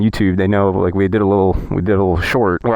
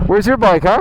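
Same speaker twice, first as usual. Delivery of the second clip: teasing; 10 semitones higher than usual. Pretend. Teasing Eve on the street.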